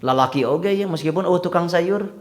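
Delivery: ordinary delivery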